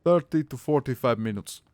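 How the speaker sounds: a hammed-up angry Russian accent telling off a cheeky viewer. Streamer scolding chat member Sapo over a redemption, in a mock-angry Russian accent. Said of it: "You get five minutes of angry fucking Russian accent".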